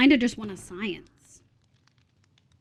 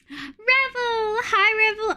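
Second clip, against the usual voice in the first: excited greeting; 10 semitones higher, much slower than usual. Real, not acted. Greeting a viewer by name.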